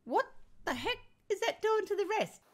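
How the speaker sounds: high-pitched, incredulous question; indignant disbelief. Real, not acted. Grandmother questioning school demands, incredulous. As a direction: ask it in exasperated disbelief.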